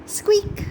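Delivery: high squeaky mouse squeak. Acted.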